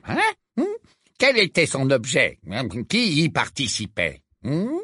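wheedling official pressing a witness; Fudge's voice. Acted.

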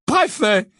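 high, loud exclamation falling at the end; stunned exclamation. A narrator's reading. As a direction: blurt it out in loud disbelief. Stunned repeat of the word.

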